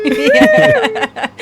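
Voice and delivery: very high wailing siren noise; siren imitation. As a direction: wail like a police siren. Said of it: "Can we have a little demo of that?".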